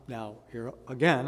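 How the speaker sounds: ordinary talk, nothing marked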